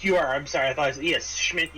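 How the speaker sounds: normal talking voice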